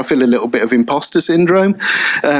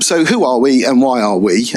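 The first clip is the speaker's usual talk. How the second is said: ordinary delivery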